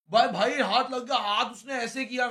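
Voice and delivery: blurting out the key moment to the host, worked up and loud; excited outburst. Real, not acted. Telling the host the hand landed, loud and excited.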